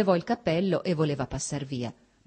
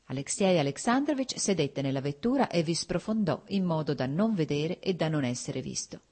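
ordinary delivery

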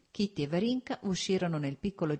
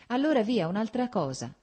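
a quick, high, louder aside to change the subject; hurried; 7 semitones higher, 5 dB louder than usual. A narrator's reading. Varinka hurriedly skips the painful song, hurried. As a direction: rush past it, Allora via, clearly flustered. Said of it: "aggiunse in fretta".

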